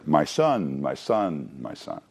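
"My son" repeated low, each sinking further; emphatic repetition. Shown as a demonstration. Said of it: "notice even the emphasis there".

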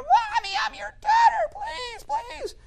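put-on high, loud screaming little girl; very high-pitched desperate child's plea; pleading scream. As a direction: scream and beg like an abandoned child. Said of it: "the girl's pleading and screaming at her".

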